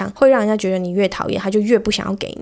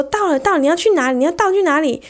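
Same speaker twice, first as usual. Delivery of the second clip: mock baffled; 7 semitones higher than usual. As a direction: play the confused boyfriend. Acts out the baffled guy. (高声困惑地追问)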